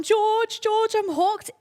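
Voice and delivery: high, loud panicked call for George; retold frantic shout for George. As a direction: yell across the lake for help. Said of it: "So I've gone".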